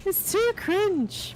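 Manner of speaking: high, slow drawn-out groan of embarrassment; mock dramatic whine. Real, not acted. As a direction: drag it out, embarrassed. Refusing to watch old videos.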